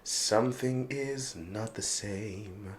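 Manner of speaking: slow breathy stage whisper; dramatic whisper. Pretend. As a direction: whisper it like a secret revelation. Wicked lyric continued, whispered.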